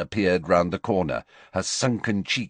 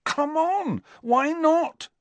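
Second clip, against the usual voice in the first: high-pitched, impatient challenge; indignant, raised-pitch protest; demanding; slower than usual. A narrator's reading. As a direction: demand an answer from a friend. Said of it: "demanded Harry".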